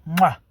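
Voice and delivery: quick loud smacking kiss, lips popping; a fast playful mwah of a kiss; loud mock smooch; kiss sound. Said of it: "I just went".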